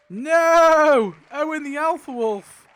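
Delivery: loud, high cry of no; anguished shout. Real, not acted. Reacting to Owen's death, shouting no in dismay. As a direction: yell it out in real dismay. Big character death on wheel.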